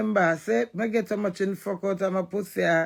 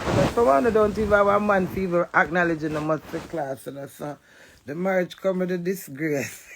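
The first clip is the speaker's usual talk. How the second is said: usual speaking voice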